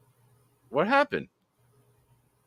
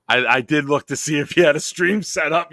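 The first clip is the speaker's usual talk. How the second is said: giggly, higher confession; laughing confession; 6 semitones higher, 7 dB louder than usual. Real, not acted. Host caught by a co-host, laughing confession. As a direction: admit it while laughing.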